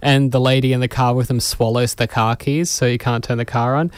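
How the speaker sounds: ordinary talk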